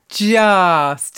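slow, stretched happy words; drawn out happy. Shown as a demonstration. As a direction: elongate each word happily. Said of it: "if you always elongate a certain word when you're happy".

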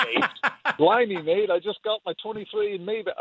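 mock British accent. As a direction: put on a jokey British accent for Nate. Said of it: "I can adopt the accent".

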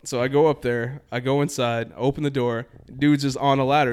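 ordinary talk

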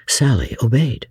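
ordinary delivery

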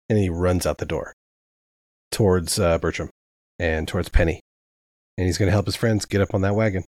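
normal talking voice

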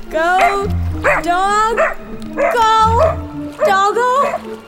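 high, rising cheers; urging on. Acted. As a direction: coax the dog on, word by word. Urging the dog to swim.

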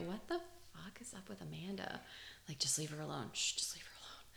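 unvoiced gossiping whisper; whispering coworkers.

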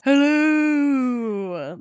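loud drawn-out mock greeting; high and loud, held long; Seinfeld hello. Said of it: "of the Seinfeld".

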